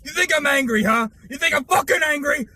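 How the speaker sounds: shouting, swearing tirade into the camera; all-out screaming fury at the camera; furious rant. Acted. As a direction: explode with rage at the VTubers.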